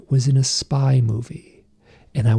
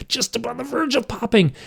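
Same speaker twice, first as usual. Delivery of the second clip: high, tight, straining voice; strained tension; 11 semitones higher than usual. Retold or imitated. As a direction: squeeze it out as if about to burst. Acting out an overinflated pool toy.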